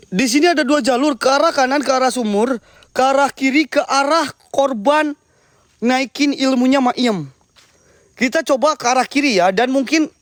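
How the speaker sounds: usual speaking voice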